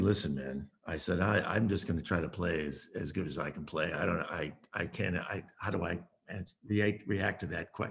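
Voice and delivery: low, quiet, stumbling reply; nervous mumble. Retold or imitated. Young sax player answers [name], quiet and stumbling. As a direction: mumble it, unsure of yourself.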